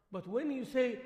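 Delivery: ordinary talk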